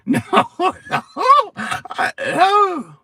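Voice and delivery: very high, loud laughing no's; shrieking with laughter; laughing cries. Real, not acted. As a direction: cry it out loudly through high-pitched laughter.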